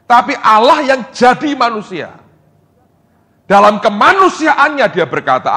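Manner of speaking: high, loud pulpit preaching; full-force sermon delivery; fiery preaching. Acted. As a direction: drive the point home to the congregation.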